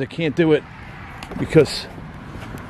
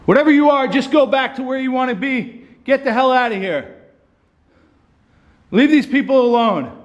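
much higher, forceful banishing call; commanding the spirit; 11 semitones higher than usual. Real, not acted. Ghost hunter ordering the spirit to leave, high forceful call. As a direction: order the presence out with raised voice.

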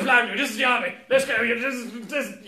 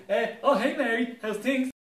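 raised, eager panto voice; panto character voice; 8 semitones higher, 6 dB louder than usual. Acted. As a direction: greet her like a panto lad. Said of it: "I'm gonna do all the roles".

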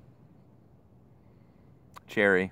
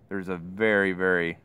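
speaking around pipe stem; pipe in mouth; 3 dB louder than usual. Real, not acted. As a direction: lips gripping pipe. Seen 1: Pipe stem held between lips throughout labelled words.

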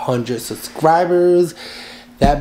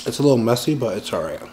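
chewing while talking; eating a burger; mouth partly full; 2 semitones lower, faster than usual. Real, not acted. Seen 2: burger held, bite taken earlier, jaw and lips move chewing-like.

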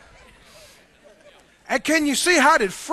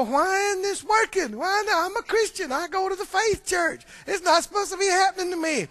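imitating an upset believer, pitched higher; whining believer; 7 semitones higher than usual. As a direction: whine like a let-down churchgoer. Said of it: "start crying and get all upset".

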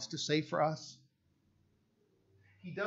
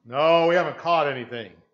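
called-back reply; 15 dB louder than usual. Pretend. Disciples call back.

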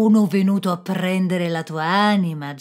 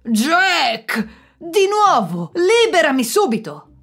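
much louder and higher furious roar; roaring devil; 10 semitones higher, 8 dB louder, slower than usual. Acted. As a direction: bellow in outrage at being tricked. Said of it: "ruggì il diavolo". (il diavolo ruggisce furioso, molto più forte e acuto)

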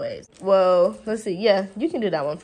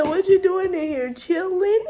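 imitating daughter; 9 semitones higher, 5 dB louder than usual. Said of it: "She said".

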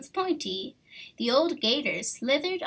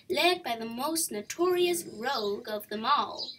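ordinary delivery, nothing marked

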